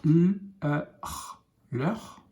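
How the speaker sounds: put-on slow letter-by-letter blending for a child; sounding out letter by letter. Shown as a demonstration. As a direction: sound out each letter of sœur, then blend into sœur. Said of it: "teacher sounds out letters".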